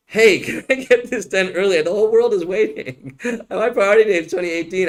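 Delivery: lightly voiced impatient client, laughing through it; half-laughing parody of a pushy client; mocking a whiny client. Lawyer mocks a client's impatient question, in a mildly whiny put-on voice. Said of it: "I'm sorry I'm making fun of you".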